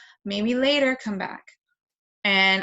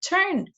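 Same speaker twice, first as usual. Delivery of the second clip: "Turn." said high and clear; modeled single word; 10 semitones higher than usual. Shown as a demonstration. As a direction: pronounce "Turn." clearly and brightly for the child. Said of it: "I'm gonna move it and say".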